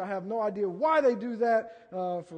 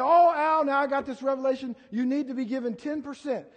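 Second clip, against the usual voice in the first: high, loud and eager, pressing the point; excited urging; 6 semitones higher, 6 dB louder than usual. Retold or imitated. Preacher urging his mentor to tithe, loud and excited. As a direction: burst out at an old friend with new zeal.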